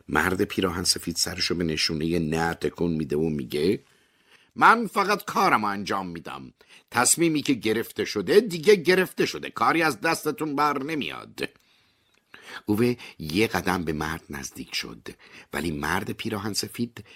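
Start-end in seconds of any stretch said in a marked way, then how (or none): 4.6-11.5 lightly voiced higher official, unbothered; cool official. A narrator's reading. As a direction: address him coolly, a touch higher. Official dismisses Ove.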